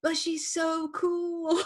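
high, very slow gushing; drawn-out admiring gush. Retold or imitated. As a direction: stretch it out in awe. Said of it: "you're like".

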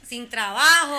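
punchline through a loud laugh. Real, not acted. Remate burlón con risa. (remate entre carcajadas)

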